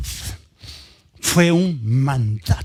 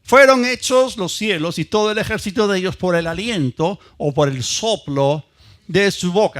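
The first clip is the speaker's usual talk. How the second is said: scripture reading; 3 semitones higher, 4 dB louder than usual. Acted. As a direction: read the psalm to the church like a proclamation. Reads the psalm aloud.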